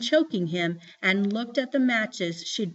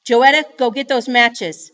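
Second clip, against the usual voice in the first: much louder, forceful mother's order; angry command; 3 semitones higher, 10 dB louder than usual. A narrator's reading. Mama orders crying Joetta to obey, angrily. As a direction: demand it with hard insistence.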